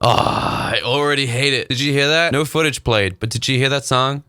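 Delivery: ordinary talk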